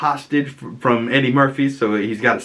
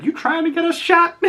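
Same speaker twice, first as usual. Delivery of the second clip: mock incredulous squeak. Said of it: "And he's like".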